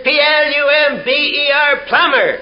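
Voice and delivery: high, emphatic spelling out letter by letter; exasperated plumber. Acted. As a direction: spell it out for someone who won't get it. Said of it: "And the old plumber says".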